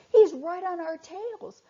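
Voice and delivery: high urgent yelling; yelled. Acted. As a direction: call out to wake a sleeping friend. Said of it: "they yelled at their friend".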